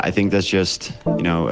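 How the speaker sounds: ordinary talk, nothing marked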